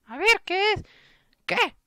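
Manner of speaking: put-on high falsetto girl's voice; cartoonish falsetto, pressing for an answer; impatient girl friend. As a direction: put on a squeaky, demanding girl voice. Girl asks to see pet.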